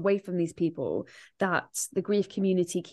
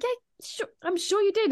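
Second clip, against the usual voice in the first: much higher, sarcastic disbelief; 12 semitones higher than usual. Retold or imitated. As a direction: drip with mock sarcasm. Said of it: "And you're like".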